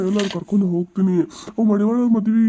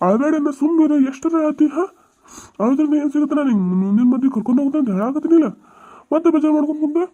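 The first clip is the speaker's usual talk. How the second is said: raised, high-pitched telling-off; insistent and impatient, pitched up; exasperated scolding; 7 semitones higher than usual. Acted. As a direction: scold someone to stop crying. Exasperated scolding of crying wife.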